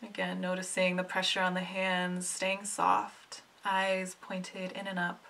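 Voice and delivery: soft, unhurried meditation voice with long pauses; hushed, soothing meditation lead; guided meditation. Acted. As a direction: gently guide them inward, letting each phrase settle.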